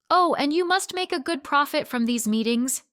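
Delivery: pointed tease. Acted. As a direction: needle him with a smile. Woman needling the manager.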